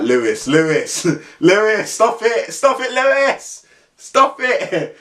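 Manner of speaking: rising, urgent, high pleading; pleading friends. Retold or imitated. Friends begging Lewis to stop, high urgent pleading. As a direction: beg a mate to shut up.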